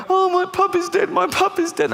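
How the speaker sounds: put-on high squeaky child wail; wailing kids. Children telling their uncle the puppy died, high wail. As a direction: whine like a heartbroken kid. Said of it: "they go".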